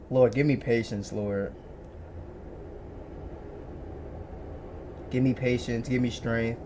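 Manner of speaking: quiet, low muttered plea for patience; praying for patience through teeth. Real, not acted. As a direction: mutter a prayer for patience under your breath. Exasperated prayer for patience.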